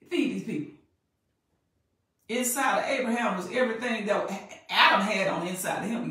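loud, high-pitched sermon delivery; preaching. Acted. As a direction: preach it out forcefully, stretching the key phrases. Preaches at full force.

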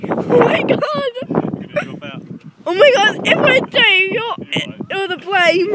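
high, loud, scared exclaiming; real panic on the ride; frightened cry. Real, not acted. Frightened on the swing.

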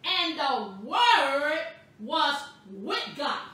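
"Word" stretched out very slowly; slow, separated words, "Word" drawn long; bold emphasis. Shown as a demonstration. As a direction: proclaim it boldly, leaning on "Word". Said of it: "so I'm gonna say it boldly".

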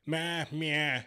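high mocking meow like a cat; mock cat noise. Mocking Finnish learners with a cat noise. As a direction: sneer it out like a cat meowing. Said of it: "a cat noise?".

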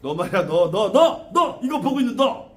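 rising, jabbing accusation; single out the viewer like a bullying spirit; mock browbeating. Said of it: "막 욱박지르는 거야".

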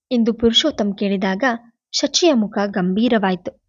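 ordinary delivery, nothing marked

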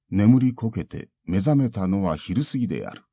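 ordinary delivery

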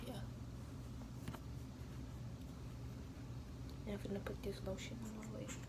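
soft unvoiced whisper throughout; whispered vlog. Real, not acted. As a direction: keep it hushed as if someone sleeps nearby. Keeps voice down while dressing.

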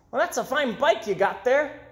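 put-on a cheerful young paperboy greeting a kid; newsboy voice. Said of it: "he said to George".

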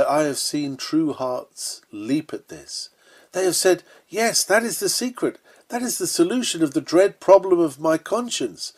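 ordinary delivery

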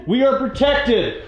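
high, slow proclamation; urgent declaration. Real, not acted. Investigator answers the demon alarm, high slow declaration.

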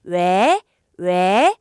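왜 rising like answering a call; second-tone demonstration. Shown as a demonstration. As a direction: ask 왜 as if someone called you, pitch sweeping up. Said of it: "누가 날 불렀다 생각하시고요". (누가 부른 듯 왜를 끝까지 올려서)

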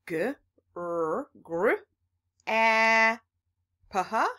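slow letter-by-letter sounding out. Shown as a demonstration. Teacher sounding out letters for kids. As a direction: break the word into separate letter sounds. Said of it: "Is this grappa?".

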